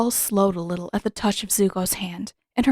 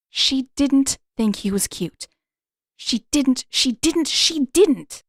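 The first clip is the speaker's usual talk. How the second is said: escalating denial; 5 semitones higher, slower than usual. A narrator's reading. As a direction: insist harder every time, ending in a cry. Heroine denies her crush, escalating.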